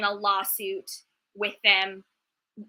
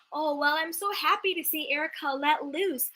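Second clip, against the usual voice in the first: higher, sugary mock sympathy; mocking Kyle; 5 semitones higher, 3 dB quieter than usual. Fan mocking Kyle's sudden concern for Erica, sugary and high. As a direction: parody a fake-sweet socialite.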